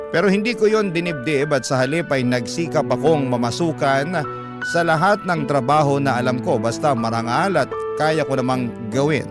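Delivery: ordinary talk, nothing marked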